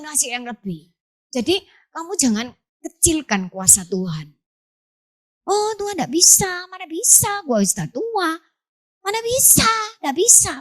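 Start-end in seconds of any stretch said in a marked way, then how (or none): 5.5-10.5 high, whiny God can't do it; mock the doubter in a high whine; doubting whiner. Doubter voiced in sermon.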